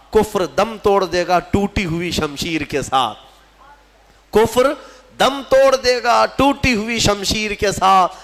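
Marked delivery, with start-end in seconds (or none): 4.3-8.1 higher, louder verse declamation; declaimed verse. Acted. Preacher repeats Iqbal's verse to the congregation, declaimed high. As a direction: ring the couplet out over the crowd. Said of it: "Iqbal نے کہا تھا".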